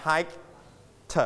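"hiked" as hike, pause, "tuh"; separated final t. Shown as a demonstration. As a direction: release the t of "hiked" as its own sound. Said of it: "we pronounce".

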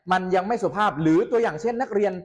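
ordinary talk, nothing marked

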